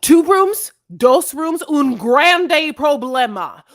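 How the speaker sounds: put-on loud, high Spanglish in an American accent; deliberately horrible Spanish. Imitating a man's language struggle for the co-host, in mock bad Spanish. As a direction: butcher the Spanish with exaggerated American vowels. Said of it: "horrible Spanish".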